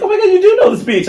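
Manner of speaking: high, fast, gushing with a laugh; mock gushing caller. Quoting an excited caller, high and fast. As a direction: play the amazed caller, way up high. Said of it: "they're like".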